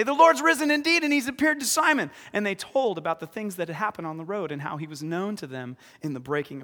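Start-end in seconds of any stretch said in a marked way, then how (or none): 0.0-2.1 higher, louder excited announcement; excited proclamation. Acted. Disciples breaking the news to the superstars, excited. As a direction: blurt out thrilling news. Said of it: "they say".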